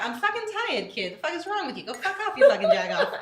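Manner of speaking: high-pitched, hammed-up Boston rant; Boston accent breaking into laughter. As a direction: build to a loud mock Boston insult. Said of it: "a telltale Boston word".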